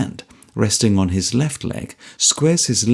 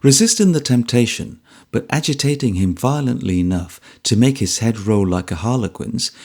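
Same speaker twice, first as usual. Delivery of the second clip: plain reading voice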